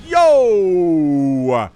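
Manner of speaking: loud, excited yell; hype shout. Real, not acted. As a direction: burst out at the big hold. Caster's hype at a hold.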